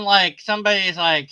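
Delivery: normal talking voice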